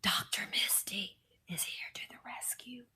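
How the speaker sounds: breathy unvoiced role-play whisper; ASMR doctor whisper. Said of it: "I could be on doing A S M R videos".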